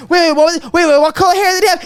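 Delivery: high, hurried mother impression; imitating interrupting mom. Mom derailing her son's joke with questions, imitated pestering. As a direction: pile on the needless questions. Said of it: "she'd be like".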